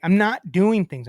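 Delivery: fed-up customer spelling it out slowly; retold exasperated refusal. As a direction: reenact digging your heels in. Retold refusal to internet company.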